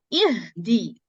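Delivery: ihdina spoken slowly and carefully; slow recitation. Shown as a demonstration. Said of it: "it's a alif mad".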